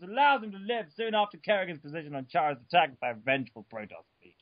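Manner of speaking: usual speaking voice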